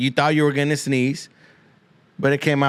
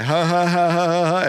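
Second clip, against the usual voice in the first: exaggerated higher-pitched fake belly laugh; imitated hard laugh; 6 semitones higher than usual. Comedian demonstrates laughing too hard, hammed-up laughter. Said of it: "laughing so hard, you're like".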